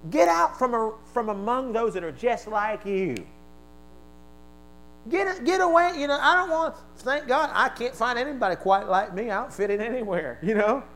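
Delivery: high, loud preacher's cry; full-force sermon delivery; fiery preaching. Real, not acted. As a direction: preach it hard to the room.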